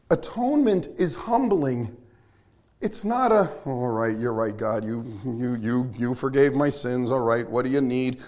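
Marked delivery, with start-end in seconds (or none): 3.6-8.2 low, grudging, defeated mumble to God; tail between the legs. Acted. Sinner giving in to God, tail between legs, low and beaten. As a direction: give in meekly, pitched well down. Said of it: "It's not the tail between the legs".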